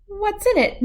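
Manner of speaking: high bright witch voice, +14 up. A narrator's reading. Witch tasting the cat's potion, high and curious. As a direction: lilt it like a cheery witch.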